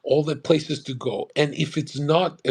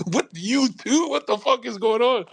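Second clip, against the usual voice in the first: much higher, laughing, stunned question; laughing disbelief; 11 semitones higher, 3 dB louder than usual. Retold or imitated. Hearing the warning again, laughing, much higher disbelief. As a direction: crack up in mock outrage. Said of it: "And I was like".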